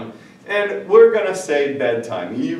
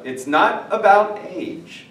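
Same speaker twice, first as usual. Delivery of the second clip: ordinary talk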